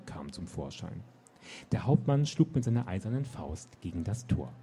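put-on lively read-aloud narration for young readers; story narration. A narrator's reading. Author reading her children's book to listeners. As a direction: tell the story with animated expression.

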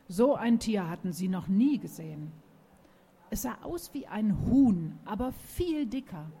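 ordinary delivery, nothing marked

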